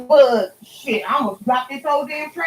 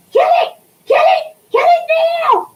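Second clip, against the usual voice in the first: shrill high scream of kill it, much higher and louder; screaming in a panic for them to kill the snake; retold screaming; 5 dB louder than usual. Screaming at her family to kill the garden snake, panicked high yell. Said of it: "I'm sitting there screaming".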